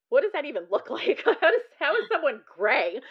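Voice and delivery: loud, high, laughing disbelief; mock the absurd remark, laughing as you go; sarcastic. Patient inwardly mocking her doctor's remark, loudly sarcastic. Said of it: "all these sarcastic thoughts".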